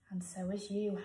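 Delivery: whispered aside. Shown as a demonstration. Teacher whispering to herself while writing, hushed. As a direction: drop to a whisper. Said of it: "aside while writing".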